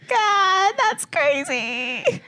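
high, drawn-out shocked gasp. Retold or imitated. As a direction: stretch it out, stunned. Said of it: "I was like".

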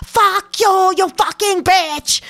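high, loud, slow cursing at the boss; furious drunk curse. Retold or imitated. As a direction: spit the insult out in a rage. Drunk woman cursing on phone.

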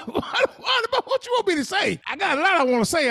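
high, loud, worked-up protest; struggling to stay calm. Real, not acted. Host defends himself to co-host, worked up. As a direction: protest as if about to boil over. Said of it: "I'm trying to be calm now".

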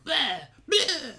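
high quick bleh death gurgle; a short cartoonish death gasp; death rattle. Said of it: "death sound is like".

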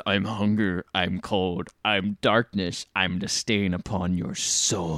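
low, slow, brooding menace; slow ominous villain. Retold or imitated. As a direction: intone it darkly and slowly. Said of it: "he says".

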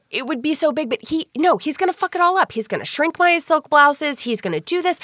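high-pitched retold fretting; acted exasperation. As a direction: imitate a friend talking herself out of it. Friend fretting about husband doing laundry.

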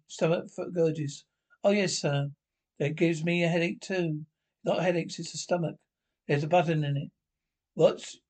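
normal talking voice

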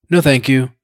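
a hint of a frosty dowager; mildly cold no; curt refusal. A narrator's reading. The dowager refusing breakfast, mildly curt. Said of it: "as if she belonged to an anti-sausage society".

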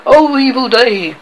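high, loud exclamation; cried. A narrator's reading. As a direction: cry out in dismay. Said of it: "cried Starkey".

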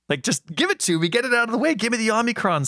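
high, pleading and exasperated. Real, not acted. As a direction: beg for it, voice climbing high. Begging to get covid.

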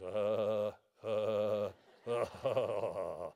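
imitating a low chuckling laugh; mock holy laughter, low. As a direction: chuckle low like the old preacher.

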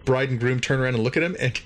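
ordinary talk

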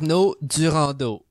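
Arnaud Durandeau said the French way with a throaty uvular R and stress on the last syllables; French accent. Shown as a demonstration. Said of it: "I love how you say it with the accent".

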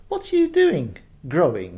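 high, loud, sassy question; turtle with attitude. Acted. Turtle challenges the lotus, high and loud with attitude. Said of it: "she asked with some attitude".

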